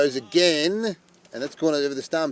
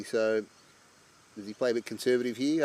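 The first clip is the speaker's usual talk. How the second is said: ordinary talk, nothing marked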